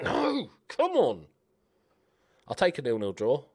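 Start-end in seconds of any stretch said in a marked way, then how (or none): 0.0-1.3 high-pitched cry of protest; exasperated cry. Real, not acted. Gamer protesting at the match, exasperated cry. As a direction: cry out in dismay, voice shooting up.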